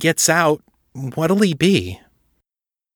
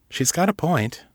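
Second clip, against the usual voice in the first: ordinary delivery, nothing marked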